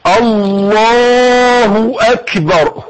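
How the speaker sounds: long slow chanted Allahu akbar; drawn-out takbir. Shown as a demonstration. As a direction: stretch God is greatest out like a call. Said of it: "قول معايا بقى".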